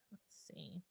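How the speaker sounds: whispered to herself; breathy unvoiced aside. Real, not acted. Muttering to herself while reading chat.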